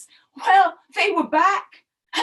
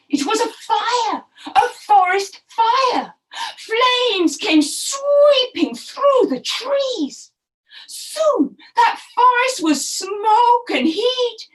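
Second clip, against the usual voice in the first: dramatic telling; 7 semitones higher, 4 dB louder, slower than usual. A narrator's reading. Dramatic fire in story.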